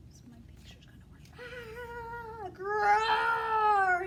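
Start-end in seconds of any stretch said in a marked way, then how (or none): 2.5-4.0 loud growling roar; bear roar. Acted. Teacher as the bear, roaring loudly. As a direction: roar like a hungry bear at the tree. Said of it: "he roared".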